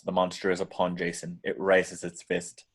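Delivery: normal talking voice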